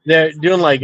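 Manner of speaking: normal talking voice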